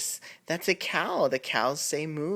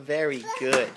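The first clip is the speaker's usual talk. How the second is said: ordinary talk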